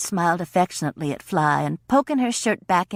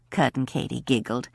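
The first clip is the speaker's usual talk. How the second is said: plain reading voice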